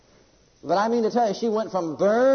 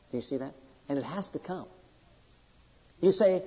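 ordinary talk

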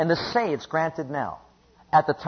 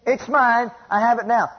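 defiant declaration; 5 semitones higher, 3 dB louder than usual. Acted. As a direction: answer the devil back, pitched up. Defiant answer to the devil.

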